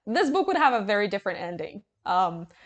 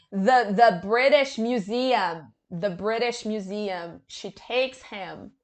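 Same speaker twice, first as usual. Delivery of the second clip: slow deadpan; slower than usual. Real, not acted. Slow deflated repetition.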